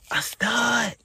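breathless high whisper of disbelief; shocked whisper. Real, not acted. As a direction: whisper it like you can't believe it. Shocked reveal of stud.

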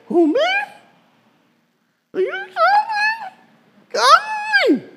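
very high, incredulous squeak; comic high-pitched who me; squeaky disbelief. Shown as a demonstration. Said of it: "you gotta say it just like that".

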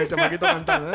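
laughing. Retold or imitated. Recalling jingle sung by kid.

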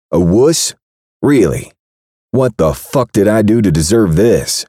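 insulted and furious; indignant anger. Acted. Braxton to Kat, indignant anger. Outraged at being called wuss.

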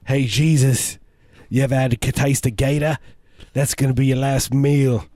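slow, drawn-out Australian threat; Crocodile Dundee. Acted. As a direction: menace them like a cartoon Aussie bushman. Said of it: "your Australian accent is".

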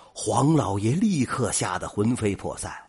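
usual speaking voice

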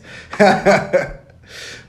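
loud high laugh at himself; laughing loudly. Real, not acted. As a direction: crack up at your own advice. Laughs at own bad advice.

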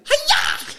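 very high martial-arts shout; mock kung-fu yell; karate cry. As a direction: snap it out like Bruce Lee. Reenacting the karate chop.

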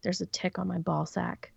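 mock whisper, voiceless; wife imitating husband whispering about a tick; whispered confession. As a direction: hush it like an embarrassed husband. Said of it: "And he's like".

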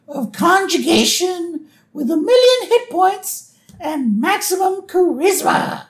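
very high, theatrical proclamation; hammed-up high cartoon wizard; grandiose wizard. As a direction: proclaim your powers with pomp. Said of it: "he's dressed as a wizard".

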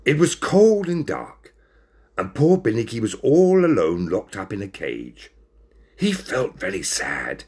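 plain reading voice

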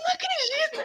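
high, loud, tearful cry of joy; overjoyed disbelief. Retold or imitated. Hearing on the phone that she passed, crying with joy. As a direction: shriek it like you can't believe it.

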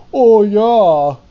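mock Californian surfer talk; surfer impression. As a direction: play a beach dude on purpose. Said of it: "Tried to say something sounded American".

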